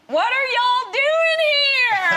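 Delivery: high, loud, drawn-out Southern holler; Southern accent. Retold or imitated. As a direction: shriek it slowly as an astonished Southerner. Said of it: "a little bit Southerny".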